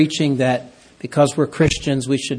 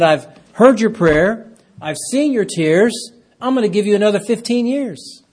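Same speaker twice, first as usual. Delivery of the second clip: exultant proclamation; 7 semitones higher than usual. Acted. Voicing God, raised and slowed.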